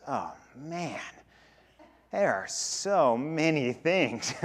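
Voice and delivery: soft, slow imitation of a child; pondering young son. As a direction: act an overwhelmed kid, drawing words out. Acts out eight-year-old son pondering.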